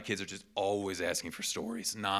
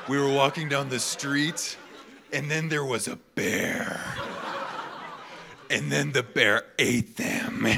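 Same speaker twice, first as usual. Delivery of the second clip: put-on little girl telling a story, slow and higher; Margot; 5 semitones higher, 4 dB louder, slower than usual. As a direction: imitate a child's dramatic storytelling, drawn out, amused. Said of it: "have her tell you guys a story".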